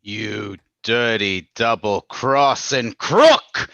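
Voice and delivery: slow, loud, furious insult; angry accusation. Acted. Abbott confronts the Raja who double-crossed him, in angry slow accusation. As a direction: spit out each word at the crook.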